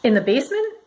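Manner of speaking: high, startled question; surprised child. A narrator's reading. Boy asks, surprised.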